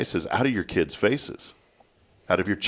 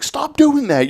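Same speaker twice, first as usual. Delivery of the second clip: higher-pitched mock scolding; exasperated scolding; 4 dB louder, slower than usual. Dad retells scolding the screaming kid, exasperated high voice. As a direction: plead with a screaming kid to stop.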